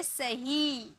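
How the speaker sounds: सही said slowly and softly, with the ही held long; drawn-out ही. Shown as a demonstration. Teacher again models the long ई in सही for students. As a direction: stretch the ही in सही. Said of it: "pronounce कर रहे ना हम".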